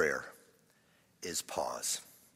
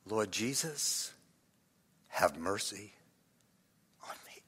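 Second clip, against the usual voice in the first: whispered prayer; 5 semitones lower, 7 dB quieter than usual. Shown as a demonstration. Preacher repeating the mercy prayer, fading whisper. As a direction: let the plea die away to breath. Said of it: "what should you whisper".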